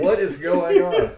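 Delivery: high, slow, loud laughing bafflement; baffled. Retold or imitated. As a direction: ask it cracking up in disbelief. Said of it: "I was like".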